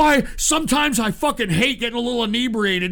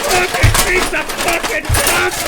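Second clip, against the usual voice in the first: screaming curses at the splice, loud and high; real rage at the audio; yelling in fury at whoever did it; furious shout; 7 semitones higher, 4 dB louder than usual. Real, not acted. Raging at bad splice.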